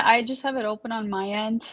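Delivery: ordinary talk, nothing marked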